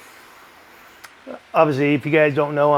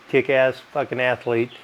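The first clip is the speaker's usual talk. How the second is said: ordinary delivery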